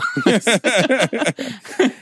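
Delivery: a burst of high laughing at the punchline; high laughter. Real, not acted. Laughing at his own dream story, high burst of laughter.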